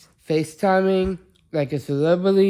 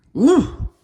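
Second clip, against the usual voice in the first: excited whoop; 10 semitones higher, 5 dB louder than usual. Real, not acted. Cheering about going to the meal.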